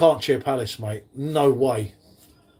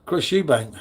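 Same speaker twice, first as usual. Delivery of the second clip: normal talking voice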